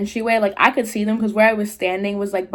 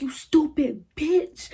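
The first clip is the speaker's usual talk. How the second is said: high, slow insult at normal volume; retold angry outburst, not really screamed; mock scream back; 6 semitones higher, 4 dB quieter, slower than usual. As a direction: retort with pitch raised, drawn out. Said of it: "started screaming back at her".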